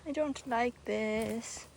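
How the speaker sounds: normal talking voice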